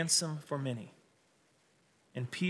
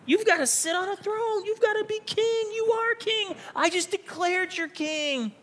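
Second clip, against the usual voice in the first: much higher, pleading voice; pleading Peter. Acted. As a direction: beg him to take the throne. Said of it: "He's like".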